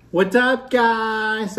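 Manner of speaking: high, bright hello to viewers; excited greeting. Real, not acted. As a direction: greet the camera with a lift.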